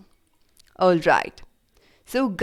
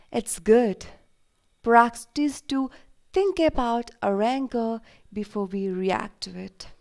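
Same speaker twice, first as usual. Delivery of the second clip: guided meditation; 4 dB quieter than usual. Acted. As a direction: guide children gently into calm, phrase by phrase.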